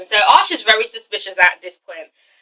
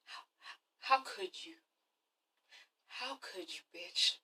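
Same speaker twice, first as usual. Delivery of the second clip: barely audible, low acted reproach; hushed betrayal; 6 semitones lower, 25 dB quieter than usual. Boyfriend looking at girlfriend he thinks lied, hushed betrayal. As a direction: breathe it out in stunned mock hurt.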